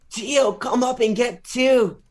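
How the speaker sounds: high, slow mock yell from the corner; imitating Eddie Bravo screaming at Gio; slow, high-pitched coaching shout; retold scream. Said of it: "Eddie Bravo screams".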